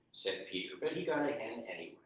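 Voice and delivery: ordinary delivery, nothing marked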